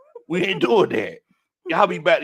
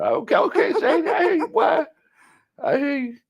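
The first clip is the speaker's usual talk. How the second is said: high-pitched playful approval; teasing hype; 13 semitones higher than usual. Real, not acted. Hyping Shady's smooth voice, high and teasing. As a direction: pitch it way up, egging him on.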